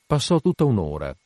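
ordinary delivery

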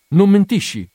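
high, loud and urgent; pleading with him not to lie; anxious plea. Acted. Girl begs the sailor for truth, high anxious plea.

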